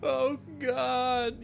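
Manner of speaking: pained, tearful patient blurting out his guilt; distraught injured patient. Acted. Billy confesses hitting his father to the doctor, tearful and pained. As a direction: plead through the pain, half crying.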